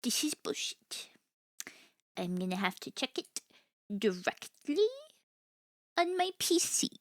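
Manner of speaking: hushed, breathy muttering to herself; whisper. Real, not acted. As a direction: whisper it under your breath. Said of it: "I don't know why I'm talking like that".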